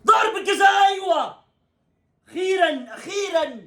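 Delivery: high joyful shout of relief; relieved cheer. Real, not acted. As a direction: cheer at last. Penalty given, celebrating.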